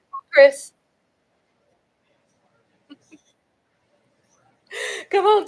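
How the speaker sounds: usual speaking voice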